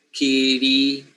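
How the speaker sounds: "kiri" as two syllables, kee-ree; inserted E sound. Shown as a demonstration. Teacher demonstrating Samoan cluster splitting, inserted vowel. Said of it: "the sound E".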